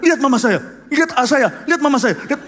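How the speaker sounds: high, loud, insistent; glaring outburst. Retold or imitated. Father glaring at his son in the restaurant, loud angry outburst. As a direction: fire it at your son. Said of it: "matanya itu langsung melotot ke saya".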